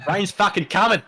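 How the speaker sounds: loud, high-pitched mock bogan yell; Broadmeadows bogan accent. Commentator translates the rain forecast on request, broad bogan accent. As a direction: ham up a bogan Australian forecast. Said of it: "Can we have some Broadmeadows Bogan".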